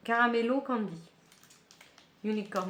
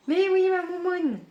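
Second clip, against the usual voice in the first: pet talk; 9 semitones higher, 7 dB louder than usual. Real, not acted. Talking to her pet.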